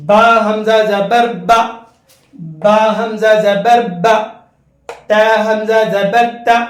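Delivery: put-on sing-song spelling drill for children; recitation drill. Shown as a demonstration. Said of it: "کیسے پڑھیں گے؟". (حمزہ کو جھٹکے سے، گا کر ہجے کریں)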